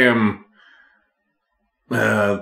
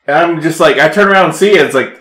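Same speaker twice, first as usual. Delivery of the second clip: usual speaking voice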